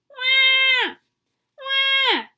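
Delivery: put-on high, loud baby cry; baby wail. Baby crying in the library, loud high wail. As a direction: wail like a newborn.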